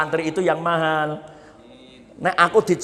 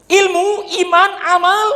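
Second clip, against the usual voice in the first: called out loud; 7 dB louder than usual. Acted. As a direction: shout each word high and loud to the congregation. Preacher calls out the three virtues.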